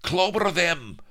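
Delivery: high, loud battle bark; rally the guards to attack; guards' yell. Acted. Guards charge the escapees.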